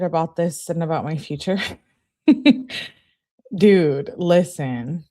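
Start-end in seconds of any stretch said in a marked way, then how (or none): 2.3-2.9 short, high burst of laughter; quick laugh at your own joke. Real, not acted.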